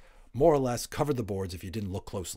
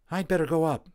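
higher, louder mother calling; mother's voice; 9 semitones higher, 8 dB louder than usual. A narrator's reading. Mother announces she'll check the boys, higher and louder. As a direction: mum deciding to check on the boys. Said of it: "said his mother".

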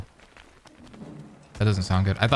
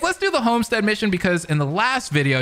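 normal talking voice

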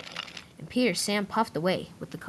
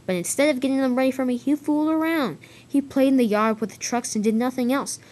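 raised, higher-pitched grievance; indignant complaint; 6 semitones higher, 5 dB louder than usual. Acted. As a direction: complain loudly about a workmate. Gordon complains about Edward to Duck.

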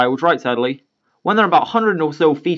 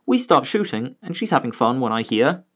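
ordinary delivery

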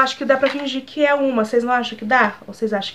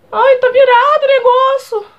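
alarmed cry; 13 semitones higher, 5 dB louder than usual. Real, not acted. Eyelash flipped, cries out.

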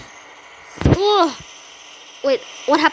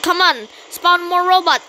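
ordinary talk

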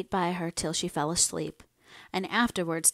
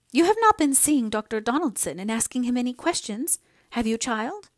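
higher, louder reproachful question; a little displeased; 5 semitones higher, 5 dB louder than usual. A narrator's reading. Mother questioning daughter about the doctor, a little displeased. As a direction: press the child with mild reproach. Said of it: "as if a little displeased".

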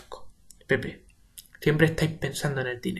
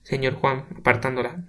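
plain reading voice

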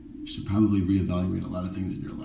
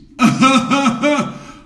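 a camp high shriek of laughter; 8 dB louder than usual. Real, not acted. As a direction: burst out in a high, loud cackle. Laughing at own joke.

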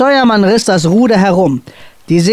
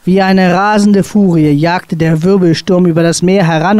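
usual speaking voice

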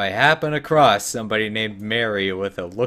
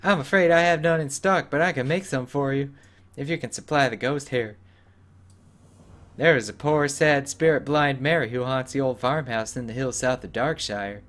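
put-on higher old hermit, explaining kindly; 5 semitones higher than usual. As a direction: switch to the frail hermit, pitched up. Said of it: "that's the dwarf voice".

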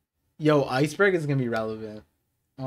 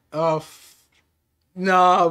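normal talking voice

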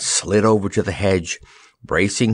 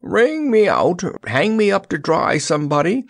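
high, woebegone plea; high-pitched soggy lament; dismal gurgle; 10 semitones higher than usual. A narrator's reading. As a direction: wail dismally as if waterlogged. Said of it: "gurgled the straw man dismally".

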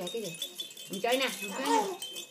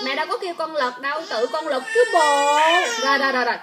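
loud soothing; 10 dB louder than usual. Real, not acted. Mother tells baby who rolled over, loud soothing.

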